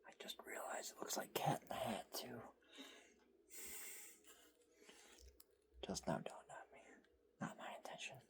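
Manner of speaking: quiet amused whisper; ASMR whisper. Real, not acted. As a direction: keep it a hushed aside.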